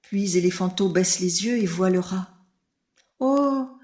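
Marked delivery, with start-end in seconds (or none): 3.2-3.7 « Oh » aigu et étonné; découvrir soudain le rat minuscule; surpris. A narrator's reading. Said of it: "dit le bébé éléphant surpris". (le bébé éléphant, très surpris)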